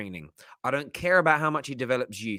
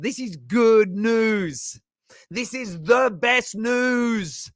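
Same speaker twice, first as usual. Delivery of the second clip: drawn-out delight; 6 semitones higher, 3 dB louder, much slower than usual. Real, not acted. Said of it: "gushing over the news".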